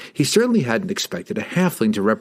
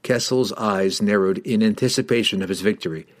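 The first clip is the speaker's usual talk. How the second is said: ordinary delivery, nothing marked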